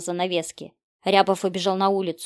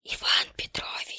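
slow, voiceless whisper; menacing whisper; 4 dB quieter, slower than usual. A narrator's reading. Killer behind the detective's back, slow creepy whisper. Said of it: "шепнул кто-то за спиной".

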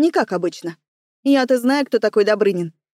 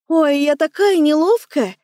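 higher, slow feigned dismay; mock apology; 4 semitones higher than usual. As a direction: play badly faked innocence. Feigned clumsiness after spilling champagne. (притворно сокрушаясь)

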